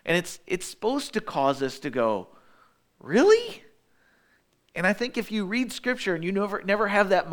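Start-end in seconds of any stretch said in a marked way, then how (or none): 3.0-3.6 high, loud rise of disbelief; shocked, questioning "Really?"; incredulous. Retold or imitated. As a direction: react as if you can't believe what you just read. Preacher acting out reader's disbelief.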